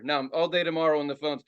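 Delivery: ordinary talk, nothing marked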